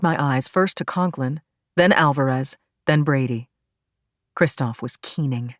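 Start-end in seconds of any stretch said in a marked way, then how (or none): none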